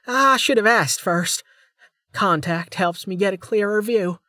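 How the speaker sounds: bright-eyed junior officer voice; young woman voice. A narrator's reading. Female officer's voice.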